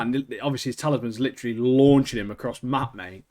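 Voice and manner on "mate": usual speaking voice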